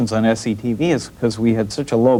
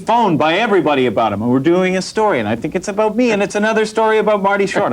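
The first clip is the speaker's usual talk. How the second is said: mock jealous whine; 8 semitones higher than usual. Mock jealous rant about Marty.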